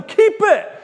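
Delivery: very high, loud shout; children in the crowd yelling loud and high; kids shouting. Retold or imitated. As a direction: yell it across the hall. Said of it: "Some are shouting".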